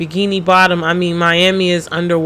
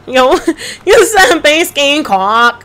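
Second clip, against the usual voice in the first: laughing; 7 semitones higher, 5 dB louder, slower than usual. Real, not acted. Laughing at own phrase.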